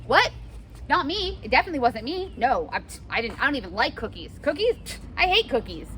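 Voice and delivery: high, flustered protest; child denying eating the cookie; guilty denial. Pretend. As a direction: overdo the innocence. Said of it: "sort of like a".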